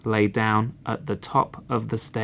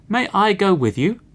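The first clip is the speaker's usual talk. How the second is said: high quick voice; 7 semitones higher, 4 dB louder, faster than usual. Acted. Cock asks, fast and high.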